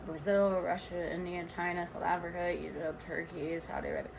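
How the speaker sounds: very soft, low rattled-off list; muttered list. Real, not acted. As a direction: reel off names under your breath. Listing countries under the breath after hello.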